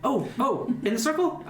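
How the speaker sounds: high-pitched, hurried character voice; arriving from a run. Acted. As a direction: act like you just ran up. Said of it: "you see Fizzle kind of, like, running down".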